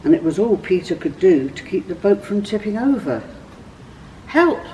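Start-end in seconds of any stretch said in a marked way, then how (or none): 4.2-4.6 sudden high call for help; cry for help. A narrator's reading. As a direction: shout help as the boat tips. Peter calls friends for help.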